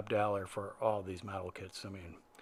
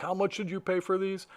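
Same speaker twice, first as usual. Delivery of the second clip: higher-pitched mock indignant demand; retold flip-out; 7 semitones higher than usual. Vlogger retelling how the seller flipped out, mock outrage. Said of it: "the guy flipped out".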